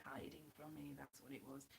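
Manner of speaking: low, very soft murmur; quiet aside. Real, not acted. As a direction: drop right down, talk to yourself. Drops back after calling out.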